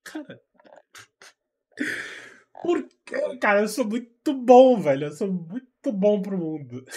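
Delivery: boastful self-talk. Retold or imitated. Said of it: "Eu falo".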